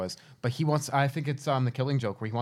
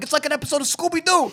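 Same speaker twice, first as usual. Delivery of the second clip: high, loud and gleeful; excited kid voice; 5 dB louder than usual. Retold or imitated. Remembering watching as a kid, gleeful and high. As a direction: squeal it like a delighted kid. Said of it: "I'm like".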